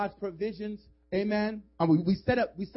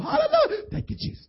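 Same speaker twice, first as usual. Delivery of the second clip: much higher mock hallelujah; squeaky mock praise; 4 dB louder than usual. Churchgoer pretending holiness, squeaky high. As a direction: fake holy squeal.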